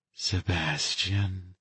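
slow, soft and hushed; softly. A narrator's reading. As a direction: breathe his name quietly. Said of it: "she said softly".

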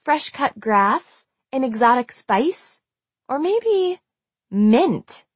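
slow, lingering guesses; teasing musing. A narrator's reading. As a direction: savor each guess to needle the listener. Marcy savors scent to taunt.

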